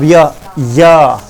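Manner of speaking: य drawn out slowly, twice; slow demo. Shown as a demonstration. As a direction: stretch य slowly to show it. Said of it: "य बोल के देखो". (धीरे धीरे य)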